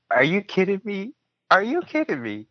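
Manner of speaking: can't believe this is working; disbelief. Retold or imitated. His disbelief at her praise.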